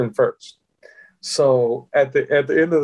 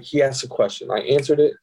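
normal talking voice

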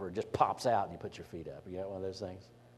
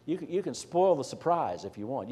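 ordinary talk, nothing marked

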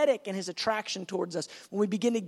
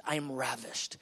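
low, soft declaration of love; tender voice of God; 9 semitones lower, 7 dB quieter than usual. Acted. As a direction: answer doubt quietly, deep in the voice. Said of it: "He goes".

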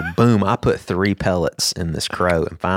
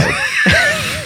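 high laugh burst. Real, not acted. Podcast host cracking up at the dead crow, shrieking laugh. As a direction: let out a high yelp of laughter.

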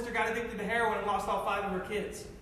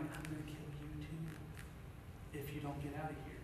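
low, much softer threat; quiet menace; 7 semitones lower, 16 dB quieter than usual. Retold or imitated. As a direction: threaten him under your breath. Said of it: "And I'm angry".

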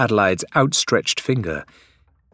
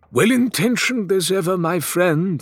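higher, gentle and reassuring; consoling; 11 semitones higher than usual. A narrator's reading. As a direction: soothe a worried friend. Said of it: "Faisal said consolingly".

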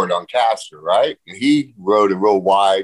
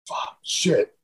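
softer, voiceless curse under the breath; whispered curse; 5 semitones higher, 7 dB quieter than usual. Retold or imitated. Driver runs out of gas at the hilltop, whispered curse. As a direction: hiss it like you just saw the empty tank. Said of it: "he looks at Wally and goes".

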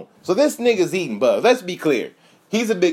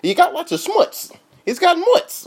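higher, loud New York wiseguy voice; old New York guy; 8 semitones higher, 3 dB louder than usual. Retold or imitated. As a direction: hustle it like a fast-talking old-timer. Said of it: "He's like".

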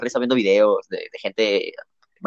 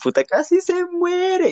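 much higher, slow, breathy squeak of disbelief; shaky gasp of shock; slower than usual. Retold or imitated. Retelling his boss's fall to a friend, high stunned gasp. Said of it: "tomé un pequeño airecito y dije".

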